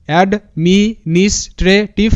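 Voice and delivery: slow, with gaps between syllables. Shown as a demonstration. Teacher puts the broken word together for students, syllable by syllable. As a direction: sound out administrative piece by piece. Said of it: "ভাঙবো তারপরই উচ্চারণটা করব".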